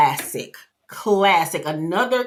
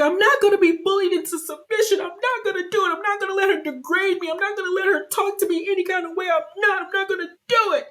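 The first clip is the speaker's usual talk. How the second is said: high, fast, frantic mock tirade; imitating crying Angie; 13 semitones higher, faster than usual. Voicing Angie's breakdown at the table, high and frantic. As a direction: play the cast member melting down, high and rushed. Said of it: "She is crying".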